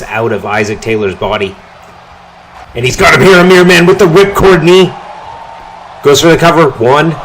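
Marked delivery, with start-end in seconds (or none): 2.7-7.1 rising, loud near-fall call; excited call. Real, not acted. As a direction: shout it as the pin lands. Calling a near-fall finish.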